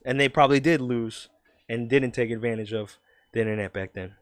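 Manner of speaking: normal talking voice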